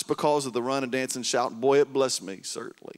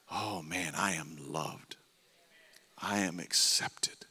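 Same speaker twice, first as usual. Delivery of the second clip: hushed inner assurance; 5 semitones lower, 7 dB quieter, slower than usual. Acted. Inner assurance voiced softly.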